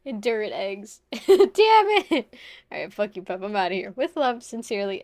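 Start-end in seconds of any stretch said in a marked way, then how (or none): 1.1-2.3 much higher, louder "Damn it!"; frustrated outburst. Real, not acted. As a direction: shout it at the game in mock rage. Game failure outburst.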